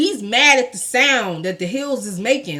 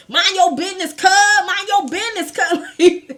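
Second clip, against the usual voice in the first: high, mock-confrontational Cali voice; Cali accent, going in; 9 semitones higher, 5 dB louder than usual. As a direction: go in on him like a South Central guy. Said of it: "He was going in on the dude".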